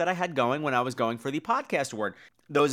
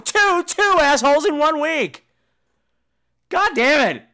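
loud, high booing announcement; hammed-up cheer and boo; mock outrage; 10 semitones higher, 7 dB louder, slower than usual. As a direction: yell the award like a heckler. Crowning twin asshole award.

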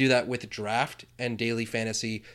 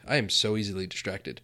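normal talking voice

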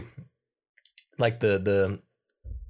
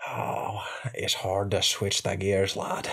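slow, lower Irish accent; Sweeney Todd Irish accent; 4 semitones lower, 3 dB quieter, much slower than usual. Shown as a demonstration. Host switching to his stage voice, slow Irish accent. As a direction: draw it out as an Irish lad. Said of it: "But then in Sweeney Todd".

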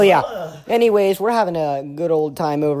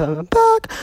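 voice crack; 9 semitones higher than usual. Real, not acted. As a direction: voice cracking high. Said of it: "sorry for the voice crack".

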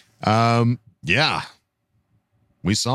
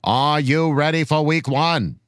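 put-on measured, old-fashioned presidential speech delivery; grand political orator; 5 semitones higher than usual. Reading a fan's rallying question to Charger Nation, grand oratory. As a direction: deliver a solemn, rousing address with dropped R's.

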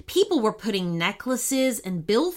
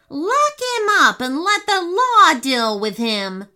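loud passenger; 8 semitones higher, 6 dB louder than usual. Acted. Angry passengers call out.

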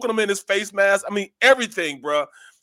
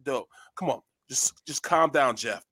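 calming a teammate; 7 semitones lower, 6 dB quieter than usual. Retold or imitated. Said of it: "I'm like".

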